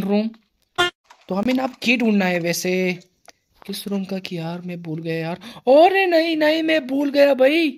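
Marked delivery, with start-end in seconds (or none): 5.7-7.7 high, loud alarmed cry; real panic, yelled out high; panicked yelp. Real, not acted. Forgot the room, panicked outburst.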